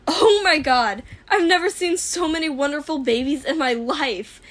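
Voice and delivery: loud, high, gushing with delight; excited exclaim. Acted. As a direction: burst out thrilled at the sight. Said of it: "May exclaimed".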